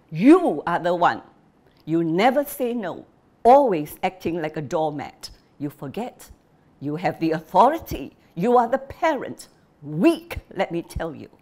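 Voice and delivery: loud, accusing father's rant; an angry husband berating a meek wife; scornful father. Acted. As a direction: hammer her with blame. Father blaming his wife.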